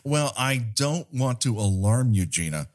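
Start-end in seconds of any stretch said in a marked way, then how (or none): none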